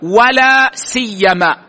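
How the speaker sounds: ولا سيما said slowly, the و clearly sounded; teacher models ولا سيما with the و; pronunciation demo. Shown as a demonstration. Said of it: "وهذا أفصح ما ورد فيها". (نطق ولا سيما بالواو ببطء)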